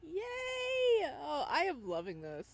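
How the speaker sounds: high delighted squeal; excited cheer. Real, not acted. Streamer cheers at game.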